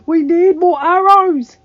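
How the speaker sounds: high, drawn-out yell; very loud, urgent call; shouting to those below. Acted. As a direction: bellow a battle order down from the walls. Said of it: "he shouted to the stewards below".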